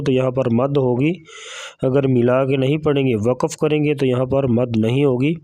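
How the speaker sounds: ordinary talk, nothing marked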